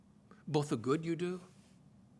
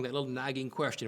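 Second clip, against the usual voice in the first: normal talking voice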